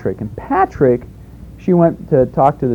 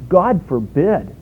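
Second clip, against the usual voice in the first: slow, weighty "God forbid"; emphatic; 4 semitones higher, much slower than usual. Retold or imitated. Quoting King James, slow and emphatic. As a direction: lean on each word of "God forbid" slowly. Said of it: "That's what you call an emphatic statement".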